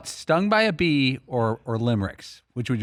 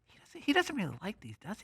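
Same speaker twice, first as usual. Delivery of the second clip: very soft, higher mock aside; background aside; 8 semitones higher, 12 dB quieter than usual. Cohost imitating McLovin's doubting background remark, hushed aside. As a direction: mimic someone murmuring from the back of the room. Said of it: "you hear in the background McLovin go".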